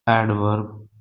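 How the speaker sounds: usual speaking voice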